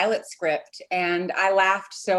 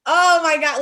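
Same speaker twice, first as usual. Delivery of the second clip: excited; 7 semitones higher, 7 dB louder than usual. Retold or imitated. Recalls reading the script.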